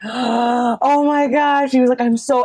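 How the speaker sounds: gasp, then high, drawn-out awe; excited, thrilled. Retold or imitated. Fan hearing the Kickstarter in the car, excited awe. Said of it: "how excited he was and smiling".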